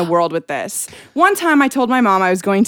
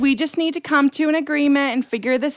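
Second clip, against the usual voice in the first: mom's voicemail. Retold or imitated. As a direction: act out mom's measured suggestions. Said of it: "Anyways, I'll repeat it".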